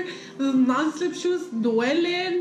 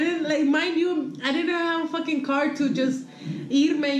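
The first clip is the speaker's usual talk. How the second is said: ordinary delivery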